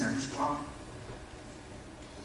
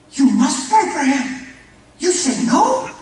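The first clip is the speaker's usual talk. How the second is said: loud, high, slow offended outburst in broken English; offended shout; 11 semitones higher, 13 dB louder, slower than usual. Retold or imitated. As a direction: shout it like a wounded father demanding prayer. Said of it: "he got really offended".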